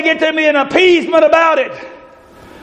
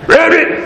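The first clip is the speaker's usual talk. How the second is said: croaked ribbit, a bit higher; frog voice; 4 semitones higher than usual. Retold or imitated. Preacher imitates croaking frogs.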